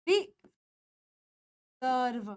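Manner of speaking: रिजर्व drawn out very slowly, ending on v; slow model word. Shown as a demonstration. Teacher slowly modelling रिजर्व for the class. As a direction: stretch रिजर्व out, ri-zerv. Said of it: "ये हो जाएगा रिजर्व".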